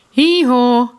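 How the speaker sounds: loud high hee-haw bray; loud donkey hee-haw. Acted. Children imitate donkey.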